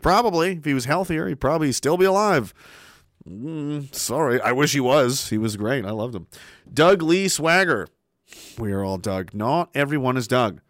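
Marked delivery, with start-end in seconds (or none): none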